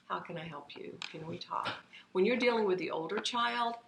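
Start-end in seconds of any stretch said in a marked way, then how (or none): 0.1-1.7 softer, sincere offer of help; present, gentle parent. Pretend. Parent, phone put away, softly asks child to talk. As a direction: set the phone down and ask gently. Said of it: "put it down and say".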